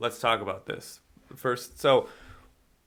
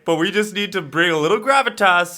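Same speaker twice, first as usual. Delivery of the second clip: higher, louder producer pitching a casting idea; mock producer voice; 7 semitones higher, 9 dB louder than usual. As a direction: play the studio exec selling it. Mocking studio's casting logic.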